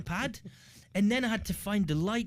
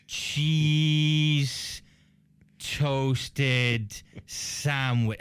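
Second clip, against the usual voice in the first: very slow dictation; painfully slow porter; much slower than usual. Retold or imitated. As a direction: drag out each word as if writing it. Porter slowly writing down order.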